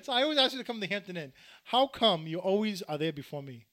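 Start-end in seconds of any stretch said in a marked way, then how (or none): none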